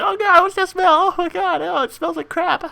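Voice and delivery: very high mock-disgusted kid; high squeaky grossed-out classmates. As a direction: squeal like kids joking about a stink. Kids joking about smell.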